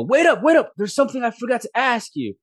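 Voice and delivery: high, loud hail; calling out. Acted. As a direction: call after someone walking away. Merchant calls after departing soldier.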